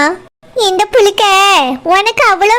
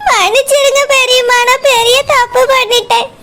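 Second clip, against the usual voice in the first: higher-pitched, crying plea for forgiveness; tearful apology; 4 semitones higher than usual. Acted. Girl begging her aunt to forgive her big mistake, crying. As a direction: beg pardon through tears. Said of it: "எதுக்கு இப்படி அழறா?".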